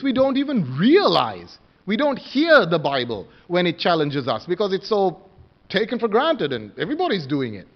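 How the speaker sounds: ordinary delivery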